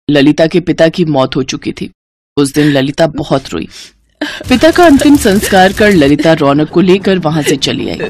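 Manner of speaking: usual speaking voice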